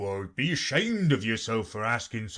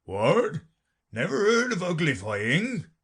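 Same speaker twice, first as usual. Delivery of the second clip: louder, astonished creature; surprised Gryphon; 8 semitones higher, 5 dB louder than usual. Acted. Gryphon astonished at Alice, surprised. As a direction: exclaim in disbelief. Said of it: "in surprise".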